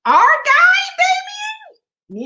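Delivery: very high, incredulous squeal of a question; Kamala Harris impression. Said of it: "my Kamala Harris voice".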